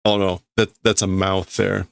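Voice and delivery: normal talking voice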